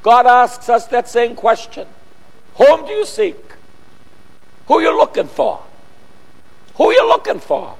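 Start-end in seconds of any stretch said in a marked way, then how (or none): 2.6-7.8 Jesus asking. Acted. Preacher voices Jesus asking.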